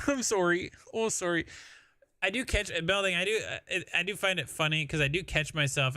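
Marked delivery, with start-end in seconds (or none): 0.1-1.5 put-on playful Canadian accent, "sorry" as SORE-ee; higher-pitched mock Canadian apology. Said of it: "or else Canada takes over".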